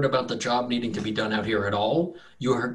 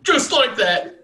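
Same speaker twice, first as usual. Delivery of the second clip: farmer voice; 12 semitones higher, 7 dB louder, slower than usual. Acted. Farmer mocks stick figure.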